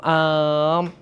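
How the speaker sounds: ordinary delivery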